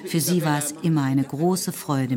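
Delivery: usual speaking voice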